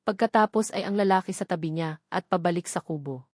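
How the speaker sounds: plain reading voice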